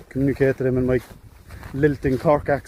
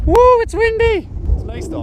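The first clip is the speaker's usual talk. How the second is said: put-on Northern Ireland accent; 6 dB louder than usual. Hillwalker shouting over the wind in a mock Northern Ireland accent. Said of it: "in a Northern Ireland accent".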